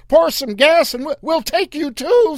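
very high, loud desperate yell. Acted. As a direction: beg at full volume. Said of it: "someone yelled".

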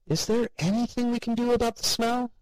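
lightly voiced younger man. A narrator's reading. Eric voiced, far higher.

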